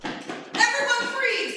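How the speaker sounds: high, loud, slow barked order; police command. Acted. FBI agent bursting into the room, loud command. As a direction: burst in and take control.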